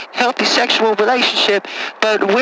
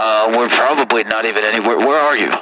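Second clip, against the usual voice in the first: put-on much lower, rough-talking good ol' boy; deep caller voice; 11 semitones lower than usual. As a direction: play a low-voiced country caller. Same caller voice.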